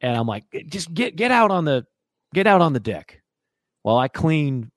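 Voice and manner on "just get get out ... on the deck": raised, exasperated mock ordering-out; stammered scolding order, acted out louder and higher; retold angry yell. Owner banishing his dog to the deck, retold yell. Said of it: "when I yell at him".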